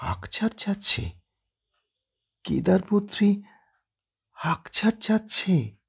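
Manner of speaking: whispered, dazed repetition; slowly, half whispered, in disbelief; slow and soft. Acted. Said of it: "আস্তে আস্তে উচ্চারণ করেন".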